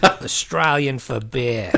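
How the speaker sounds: slow mock Aussie beer slogan; Australian ad parody, amused; Australian accent. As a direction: draw out the slogan in playful Aussie.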